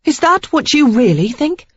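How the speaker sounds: high, loud disbelief; incredulous. A narrator's reading. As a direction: turn back, stung and disbelieving. Sarah wounded and incredulous.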